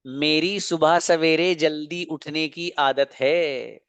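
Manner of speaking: slow, measured reading for students; teacher slowly dictating a sentence; slow dictation. Shown as a demonstration. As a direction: draw the words out so students can follow.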